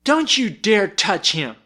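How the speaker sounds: put-on high, slow little-boy threat; a small boy warning him off; piping threat. A narrator's reading. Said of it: "piped a snowbird dangerously".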